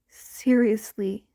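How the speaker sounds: ordinary delivery, nothing marked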